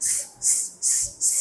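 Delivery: "স" as a voiceless hiss, no vowel; isolated sibilant bursts on each "স"; hissing s sound. Shown as a demonstration. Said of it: "এরকম একটা sound হবে".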